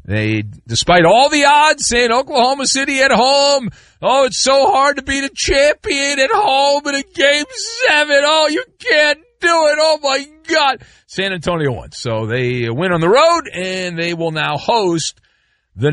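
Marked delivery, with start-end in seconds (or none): 4.0-10.8 high, whiny hammed-up wail; mock despair. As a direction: wail in exaggerated, mocking disbelief. Mocks pundits fretting over odds.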